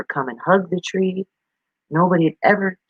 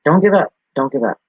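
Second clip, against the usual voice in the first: usual speaking voice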